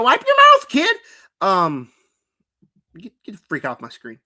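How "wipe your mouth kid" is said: mock yell. Real, not acted. Mock scolding a figure on screen.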